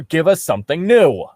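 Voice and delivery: usual speaking voice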